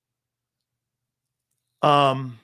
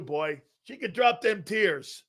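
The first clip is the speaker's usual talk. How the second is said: ordinary talk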